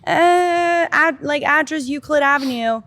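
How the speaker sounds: high, loud, drawn-out "uh", then stumbling on; imitating her own helpless, panicked younger self; tearful fluster. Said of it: "And then I start crying".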